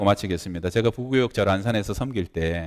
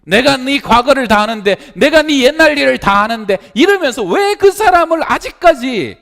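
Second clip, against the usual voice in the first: high, loud, impassioned sermon voice; fervent preaching; 12 dB louder than usual. Acted. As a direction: preach it hard to the congregation. Preaching at full force.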